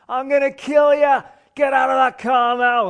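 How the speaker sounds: high, loud, slow furious scream of threats. Retold or imitated. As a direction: bellow it like a man gone mad at the car. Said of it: "He just starts shouting and screaming threats".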